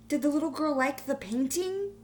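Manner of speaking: put-on high, loud eager kid voice; child's question. A narrator's reading. New student asks art teacher, high and eager. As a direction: ask like a curious fifth grader. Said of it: "the new student asked".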